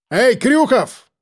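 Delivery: slow high call into the dark. A narrator's reading. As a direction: shout the name slowly. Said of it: "крикнул я".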